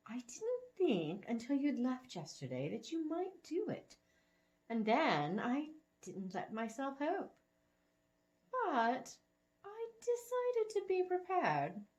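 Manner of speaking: put-on a sweet old woman, pleased; elderly lady.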